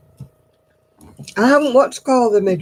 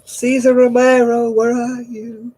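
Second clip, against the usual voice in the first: sing-song calling; 5 semitones higher, 5 dB louder, slower than usual. Pretend. Mock calling for dog.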